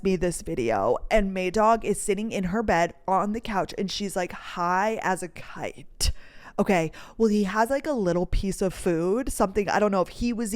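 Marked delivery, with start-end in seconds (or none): 4.3-6.1 soft whispered, slow and hushed. Acted. Telling listeners about the drugged dog, whispered slowly. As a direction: breathe it out like a secret.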